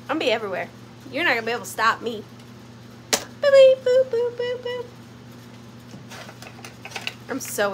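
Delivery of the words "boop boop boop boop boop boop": high monotone boops, fading in volume; imitated metal detector boops. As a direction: sound like a detector going off, pitched way up. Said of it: "I'm gonna be like".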